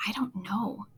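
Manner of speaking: whispered, unsure little frog. Acted. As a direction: breathe it out, not sure at all.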